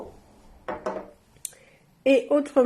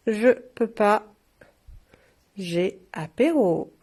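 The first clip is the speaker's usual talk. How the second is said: slow repeat; 2 semitones lower, slower than usual. Shown as a demonstration. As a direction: savour each word slowly.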